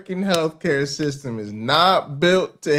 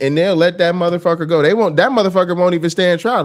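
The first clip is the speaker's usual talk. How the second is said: normal talking voice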